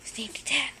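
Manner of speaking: normal talking voice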